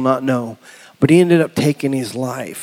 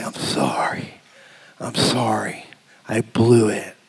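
soft, low, humbled apology; sincere apology; 4 semitones lower, 6 dB quieter, slower than usual. Acted. Preacher retelling apology to his daughter, softly sincere. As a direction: let each phrase land quietly.

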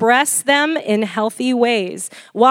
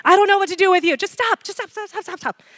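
high pleading fading to tiny repeated stops; mock frantic begging, shrinking away; panicked parent; 8 semitones higher, faster than usual. Acting a parent panicking at crying.

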